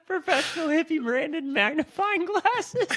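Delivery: high, slow announcer voice; mock ad pitch. Pitching a silly product to his friend, mock announcer. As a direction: sell it like a cheesy commercial.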